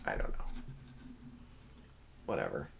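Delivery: normal talking voice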